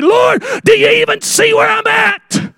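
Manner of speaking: high-pitched desperate shout; panicked cry. Retold or imitated. Believer going to the Lord in panic, high desperate cry. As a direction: cry out to God at the top of your range. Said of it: "to the Lord in my panic".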